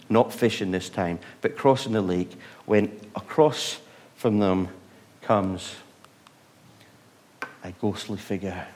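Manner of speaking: usual speaking voice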